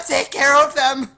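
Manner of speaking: high, loud shouted order; calling out. Acted. Player as the character ordering Sam onto the enemies, shouting high. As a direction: holler it across to a comrade.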